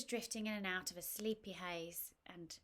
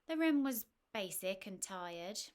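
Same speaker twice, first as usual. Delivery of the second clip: usual speaking voice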